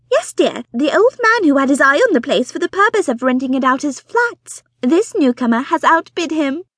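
high, loud, breathless society wife; fussy excited mother. Acted. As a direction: gush it out like an eager matchmaking mother. Mrs Bonnet explaining.

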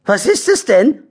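high, altered character voice; eager badger voices. Curious badgers ask Harry about his find, high eager voice.

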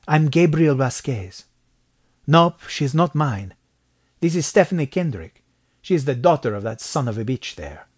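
put-on Mexican-accented man, firm introduction. A narrator's reading. Narrator voices Mexican man introducing himself.

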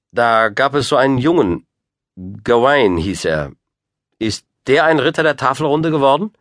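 put-on high, pointed boy's question; young Lancelot. A narrator's reading. Boy asking about a knighted rival, high and pointed. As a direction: speak up high and firm as a lad.

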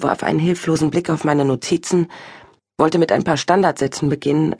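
usual speaking voice

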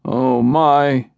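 husband moaning into the phone. A narrator's reading. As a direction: groan it out in despair. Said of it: "he moaned".

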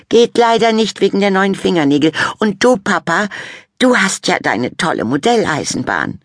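put-on high, loud teen girl; condescending praise for mum; vain Fabienne. A narrator's reading. Sister condescendingly praising mum's garden, high and loud.

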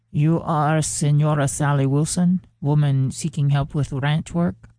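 put-on gentle, polite foreign accent with careful English; soft, courteous accent of a traveller asking for work; soft elegant accent. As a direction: speak softly in a graceful foreign accent, each word placed. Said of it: "with an accent so soft and elegant".

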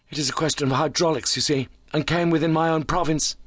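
high, animated character voice; keyed-up injured visitor. A narrator's reading.

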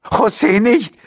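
very high alarmed mother's cry; screaming. A narrator's reading. Mother stopping father from hitting the daughter, high scream. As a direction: shriek it to stop him. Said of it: "schrie Marias Mutter".

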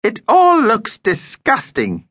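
high, drawn-out disgust; distaste. A narrator's reading. Vampire surveys the deli food for Mum, disgusted. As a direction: say it slowly, pitched high, full of distaste. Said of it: "with distaste".

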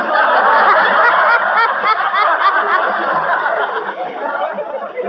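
peals of rising laughter; long rising laugh. Acted. Molly laughing at McGee's broken-record joke, rising laugh.

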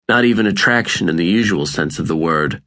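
plain reading voice

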